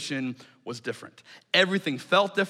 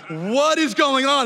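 high, loud, slow alarmed cry; alarmed guide; 8 semitones higher, 6 dB louder, slower than usual. Retold or imitated. The guide returning to a crowd, loud and alarmed. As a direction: call it out wide-eyed and startled.

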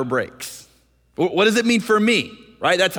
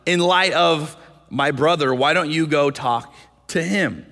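frustrated Cain. Acted. Cain snapping back at God about his brother, frustrated. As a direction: sound fed up at being asked. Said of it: "the frustration".

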